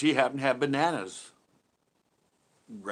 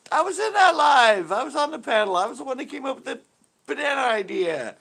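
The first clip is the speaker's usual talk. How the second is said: excited protest; 9 semitones higher, 8 dB louder than usual. Retold or imitated. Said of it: "And I'm like".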